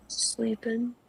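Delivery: hushed whisper so the snake stays asleep. Real, not acted. Whispering over the sleeping snake. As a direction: barely breathe the word.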